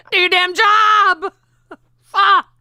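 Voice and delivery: high cry of laughing frustration; laughing while yelling. Real, not acted. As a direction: yell it, then crack up. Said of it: "my hands are shaking".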